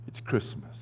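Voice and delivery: normal talking voice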